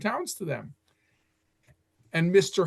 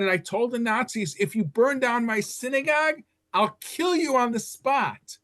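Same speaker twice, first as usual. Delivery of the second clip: fierce threat; 8 semitones higher than usual. Retold or imitated. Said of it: "with a fierce countenance".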